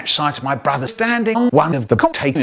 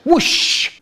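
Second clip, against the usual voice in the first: whoosh sound; 11 semitones higher than usual. A narrator's reading. Imitated bomb sound.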